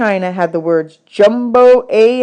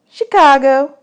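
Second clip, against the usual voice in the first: put-on much higher, bossy little girl; 7 semitones higher, 5 dB louder, slower than usual. Desi quizzing new boys about dishes, high bossy girl voice. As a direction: boss the new boys around.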